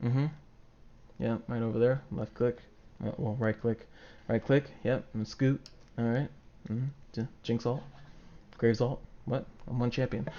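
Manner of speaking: imitating a calm player quietly clicking through a pentakill; calm focused player. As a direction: keep it cool and under your breath. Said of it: "the person just like".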